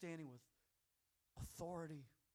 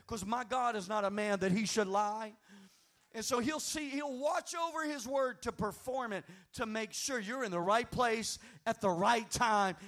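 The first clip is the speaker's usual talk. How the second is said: higher and much louder, building to a shout; full-force preaching; 4 semitones higher, 13 dB louder than usual. Real, not acted. Pastor urging the congregation to keep faith, full-force preaching. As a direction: preach it, rising to a roar.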